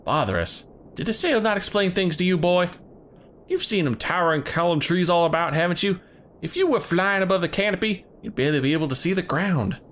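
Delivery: put-on hunter leader in a southern accent; rough hunter greeting visitors in a southern accent.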